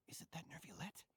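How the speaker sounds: hushed whisper. Acted. Guard warning a partner not to be noticed, whispering. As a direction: whisper so he can't hear you.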